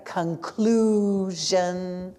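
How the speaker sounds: conclusion drawn out, leaning on the zh; slow zh demo. Shown as a demonstration. Teacher to class: slow model of conclusion. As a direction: say "Conclusion" very slowly, stretching the zh. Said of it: "S I O N".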